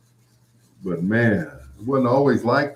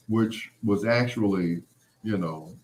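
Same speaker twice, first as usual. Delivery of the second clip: normal talking voice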